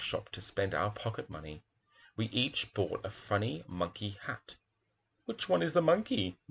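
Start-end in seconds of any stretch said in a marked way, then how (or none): 5.3-6.4 high, mocking jeer. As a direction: taunt the kid in the monkey hat. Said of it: "jeered you-know-who".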